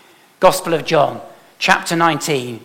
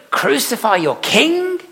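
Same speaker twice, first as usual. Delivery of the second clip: Pilate's high, disbelieving question; incredulous question; 10 semitones higher, slower than usual. Retold or imitated. Pilate asks the crowd, high and incredulous. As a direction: ask it as if it is unthinkable. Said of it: "Pilate asked".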